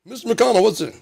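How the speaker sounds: high, loud reporter shout; reporter calling. Retold or imitated. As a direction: call out a question like a reporter.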